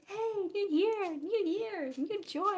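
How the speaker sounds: high, loud sing-song holiday greeting; mock-excited, bouncing cheer; festive cheer. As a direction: mimic everyone's over-bright holiday hype. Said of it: "Everyone is like".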